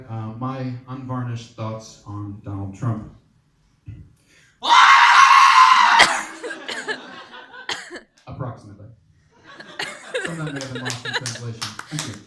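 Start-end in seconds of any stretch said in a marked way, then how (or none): 4.6-8.0 loud, high, drawn-out scream; wordless high-pitched screaming outburst; long scream. Acted. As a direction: scream at full volume as your whole answer. Screams his unvarnished thoughts on Trump.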